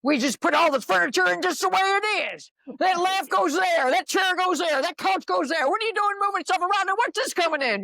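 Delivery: high, loud, fussy complaining; the ego panicking over moved furniture, high and fussy. Retold or imitated. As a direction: squeal like someone whose furniture got moved. Ego voiced as fussy person.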